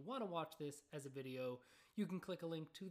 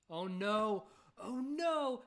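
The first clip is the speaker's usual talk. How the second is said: mock dismay; 4 semitones higher, 10 dB louder, much slower than usual. Real, not acted. Host spots a funny place name.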